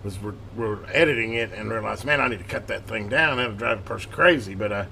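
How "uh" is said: normal talking voice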